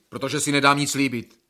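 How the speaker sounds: normal talking voice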